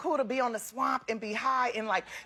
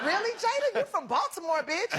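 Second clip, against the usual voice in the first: mock incredulous retort; 8 semitones higher than usual. Retold incredulous retort to friend.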